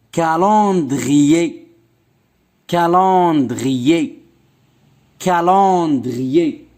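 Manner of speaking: slow, calendrier said syllable by syllable; slow model word. Shown as a demonstration. Teacher to pupils, listening for on, slow and clear. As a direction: model calendrier slowly for the class. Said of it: "teacher models word slowly".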